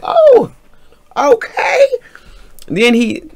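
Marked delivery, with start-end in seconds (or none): 0.0-1.9 high, loud drawn-out "oh, okay"; retold startled yelp, pitched way up; taken aback. Reacting to his dropping to the floor, taken aback.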